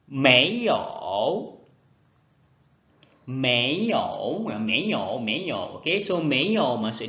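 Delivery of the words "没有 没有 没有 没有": 没有 disebut meiyou, diulang jelas; 没有 diulang berkali-kali untuk murid; latih sebutan. Shown as a demonstration. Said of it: "Sebutan dia ialah 没有". (没有, meiyou, diulang dengan jelas)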